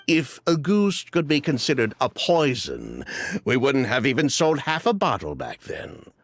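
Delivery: ordinary talk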